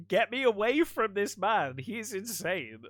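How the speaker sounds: high, drawn-out mock desperation; panicked plea. Podcaster voicing a jumper begging to escape a madman, panicked plea.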